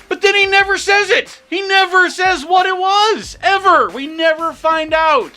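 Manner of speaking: high-pitched exasperated shouting; yelled, high-pitched frustration. Real, not acted. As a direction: rant in comic outrage, voice way up. Exasperated rant about unresolved plot point.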